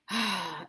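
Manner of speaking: acted despairing sigh; heavy sigh. Romeo hearing how Juliet weeps, a heavy sigh. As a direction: sigh before speaking. Romeo sighs before lament.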